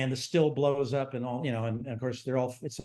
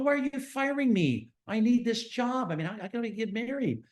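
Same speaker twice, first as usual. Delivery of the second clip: pleading protest; 9 semitones higher than usual. Retold or imitated. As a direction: re-enact a son begging for his job, pitched way up. Fired son pleading with dad.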